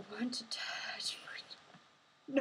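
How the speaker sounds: slow, creepy whisper; whispered reading. Retold or imitated. Streamer reading a creepy chat comment in a slow whisper. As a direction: read it out slowly in a hushed creepy whisper.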